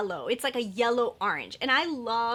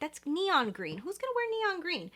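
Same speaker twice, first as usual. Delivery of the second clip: mock disgust; 6 semitones higher than usual. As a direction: sneer at the neon shade. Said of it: "I'm like".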